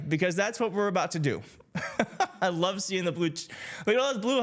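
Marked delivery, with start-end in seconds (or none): 1.7-2.4 quick breathy laugh. Real, not acted. Laughing at the chat.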